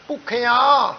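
ਭੁੱਖਿਆ with a nasalised final aa; nasal ending shown. Shown as a demonstration. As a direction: draw out the end of ਭੁੱਖਿਆ through the nose. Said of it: "ਉੱਤੇ ਬਿੰਦੀ ਉਹਦਾ ਉਚਾਰਨ ਹੋਵੇਗਾ". (ਭੁੱਖਿਆ ਦੇ ਅੰਤ ਤੇ ਬਿੰਦੀ ਦਾ ਉਚਾਰਨ)

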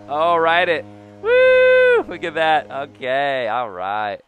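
loud, high, whooping celebration; real joy yelling at a homer; excited cheering. Real, not acted. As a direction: cheer the big hit as it flies. Celebrating a home run.

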